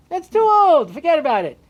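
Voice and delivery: high, loud brush-off; dismissive father. Acted. Father telling his son to forget Torah, high and loud. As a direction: wave the idea away, voice jumping high.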